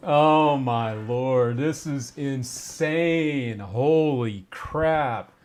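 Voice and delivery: very slow, drawn-out amazement; overwhelmed disbelief. Real, not acted. Streamer taking in a huge donation, slow stunned disbelief. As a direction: stretch each word out in awe.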